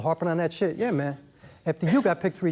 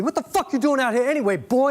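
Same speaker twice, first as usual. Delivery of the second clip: higher and louder, rough demand from a cop; 6 semitones higher, 4 dB louder than usual. Retold or imitated. Cop standing over the bleeding Saint, loud and demanding. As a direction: bark it down at him on the ground. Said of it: "and he says to me".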